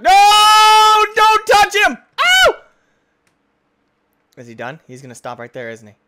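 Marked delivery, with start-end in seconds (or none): 0.0-2.5 high, loud panicked yells; scared scream. Real, not acted. Gamer screaming as the enemy attacks, high and loud. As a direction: scream in fright.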